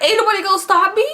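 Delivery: high, fast outraged echo; repeating the guy's words back in shrill disbelief; incredulous. Retold or imitated. Outraged repeat of his line.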